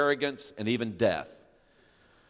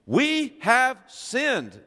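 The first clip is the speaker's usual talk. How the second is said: high, slow cry of the people; mock confession; 10 semitones higher, 4 dB louder, much slower than usual. Israelites confessing to Moses, high and drawn out. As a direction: draw out the confession, high and pleading.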